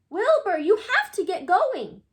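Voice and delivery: loud, high urging; urgent shout. A narrator's reading. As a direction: hurry him up loudly. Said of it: "shouted Hector".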